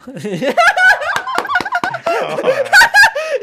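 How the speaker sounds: 裏返った高い大笑い; こらえきれず甲高く笑う. Real, not acted. 相方の返しに爆笑. (甲高い爆笑)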